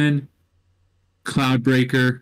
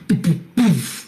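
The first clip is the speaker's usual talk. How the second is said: explosion sounds; 4 semitones higher than usual. Acted.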